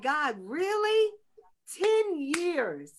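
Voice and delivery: slow, high disbelieving plea to God; disbelieving first take of ten years; asking God in disbelief. Retold or imitated. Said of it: "but I was like".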